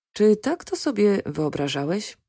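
usual speaking voice

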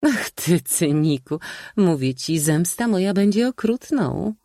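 high-pitched playful threat; mock-indignant old maid. A narrator's reading. Aunt threatening her cynical nephew with revenge, mock indignation. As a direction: scold in a high spinster voice, half in play.

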